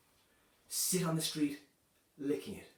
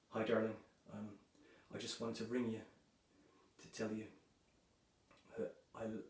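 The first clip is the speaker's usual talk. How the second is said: tender hush; 5 semitones lower, 8 dB quieter than usual. Acted. Last phone call in terror situation.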